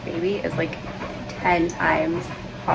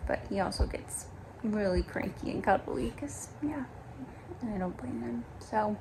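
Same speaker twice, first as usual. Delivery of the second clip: whispering; 2 semitones higher, 10 dB quieter than usual. Real, not acted. Baby nearby, hushed aside.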